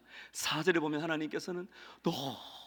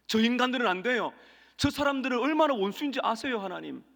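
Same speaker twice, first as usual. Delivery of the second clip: indignant Jonah, higher and louder; Jonah protesting; 6 semitones higher, 4 dB louder than usual. Acted. Preacher voicing Jonah complaining to God, indignant. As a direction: push back at God like a resentful prophet.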